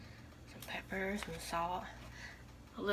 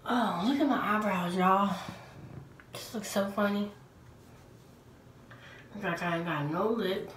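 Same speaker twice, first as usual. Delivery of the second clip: lips stiff under mask; slightly restricted mouth movement; through a face mask; 10 dB louder than usual. Real, not acted.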